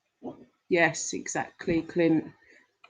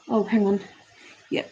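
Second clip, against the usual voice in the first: ordinary delivery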